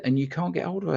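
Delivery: high-pitched indignant disbelief; retold fuming. Hypnotist to caller who lost contact with girl, retold indignation. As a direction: throw his failure back at him, incensed. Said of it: "I was absolutely fuming".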